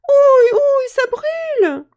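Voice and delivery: very high, loud pained fairy cry; fairy yelling in pain. Acted. Burned fairy shrieking at Jacques, high and loud. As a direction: squeal as if burned. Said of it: "qui se met à hurler".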